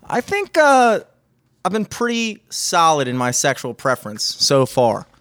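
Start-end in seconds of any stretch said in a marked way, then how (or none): none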